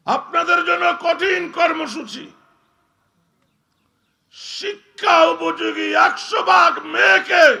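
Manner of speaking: thundering high sermon shout; preaching at full force. Acted. Preacher to the gathered crowd, thundering sermon shout. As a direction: roar it out to the whole crowd.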